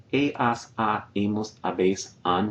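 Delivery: "he, has, ha, hemos, habéis, han" all said without H; silent H. Shown as a demonstration. Teacher reciting forms of haber with silent H. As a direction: start each form on the vowel, no H. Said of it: "without pronouncing the H at all".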